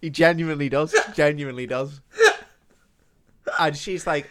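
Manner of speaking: normal talking voice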